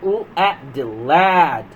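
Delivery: very slow, stretched sound-out; slow phonics blend. Shown as a demonstration. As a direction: drag it out for small children. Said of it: "slow blending demo".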